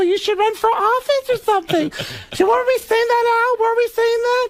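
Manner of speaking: high, gushing, clueless chatterer; Beverly D'Angelo. Acted. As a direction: babble delightedly far above your own pitch. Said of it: "gets off the elevator and goes".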